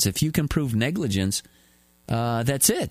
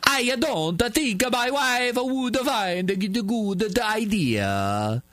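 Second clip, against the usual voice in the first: mock Dutch accent; 7 semitones higher, much slower than usual. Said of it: "told the Dutch paper".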